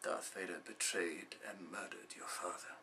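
low, hushed and grave; grave recollection. Acted. Mentor revealing a father's murder, grave and hushed. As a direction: sink into a sombre near-whisper.

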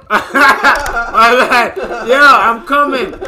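laughing through the words, high and loud; giggling apology while rushing over. Real, not acted. To teammate, laughing apology while coming to help.

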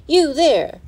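high, loud call to a stranger; shouted from behind, pitched well up; bellowed challenge. A narrator's reading. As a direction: bellow it out sharply to stop someone. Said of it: "a voice from behind bellowed".

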